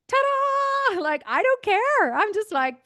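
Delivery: high, loud showbiz ta-da; mock cheery ta-da. Shown as a demonstration. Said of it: "you just have to be like this".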